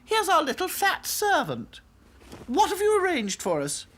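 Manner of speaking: very high, loud, demanding doll voice; haughty princess doll. A narrator's reading. Princess doll demanding service from Sad Sack, haughty. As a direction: order the servant about from on high.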